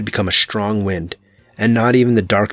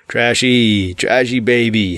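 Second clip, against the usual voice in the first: croak; slower than usual. A narrator's reading. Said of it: "His voice was a croak".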